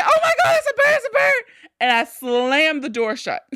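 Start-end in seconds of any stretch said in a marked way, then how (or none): 0.0-1.4 high, loud frantic yelling; hammed-up high-pitched panic; panicked mock scream. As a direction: re-enact raising the alarm, laughter creeping in. Re-enacts screaming bird alarm.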